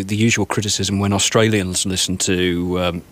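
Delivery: ordinary delivery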